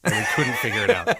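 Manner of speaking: laughing through the words. Real, not acted. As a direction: say it while cracking up. Laughing at their own failure.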